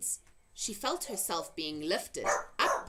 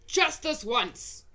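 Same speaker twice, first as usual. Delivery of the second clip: slow, loud, higher grudging growl; grudging giant; 7 semitones higher, 7 dB louder, slower than usual. A narrator's reading. Blood bottler giving in to taste, slow and grudging. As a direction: drag it out reluctantly.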